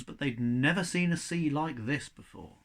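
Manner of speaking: usual speaking voice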